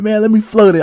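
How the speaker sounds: high, eager begging; eager pleading. Retold or imitated. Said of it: "I'm like".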